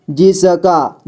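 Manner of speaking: जिसका drawn out as जि-स-का; syllable by syllable. Shown as a demonstration. Teacher showing beginners how to read जिसका, syllable by syllable. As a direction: sound out each letter of जिसका. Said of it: "जोड़ जोड़ करके ही पढ़ना होगा".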